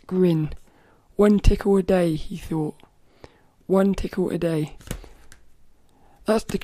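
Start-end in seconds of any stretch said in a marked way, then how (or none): 0.1-4.8 storybook reading. Acted. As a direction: read it out like to a room of kids. Rereads storybook aloud.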